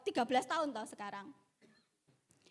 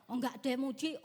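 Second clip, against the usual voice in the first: usual speaking voice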